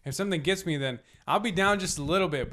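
ordinary delivery

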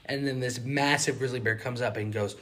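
ordinary talk

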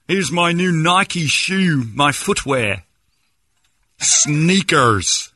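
high, drawn-out American caricature; hammed-up fake American; mock American accent. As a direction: show off in an exaggerated American accent. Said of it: "my nephews have an American accent".

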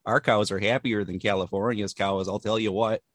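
put-on Midwestern Wisconsin twang; Wisconsin accent. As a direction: lay on a homespun Wisconsin accent. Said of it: "Wow, that was perfect".

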